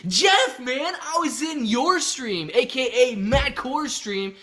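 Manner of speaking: high, loud, drawn-out excitement; big hype-man enthusiasm; hyped shout-out. Real, not acted. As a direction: stretch it out slowly, pitch way up. Hyped reply to viewer Jeff.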